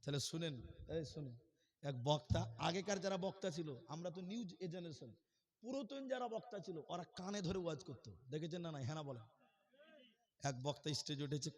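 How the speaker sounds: ordinary delivery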